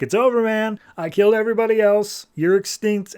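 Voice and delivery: gloating villain. Retold or imitated. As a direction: crow over a beaten enemy. Totem gloats over Buddy.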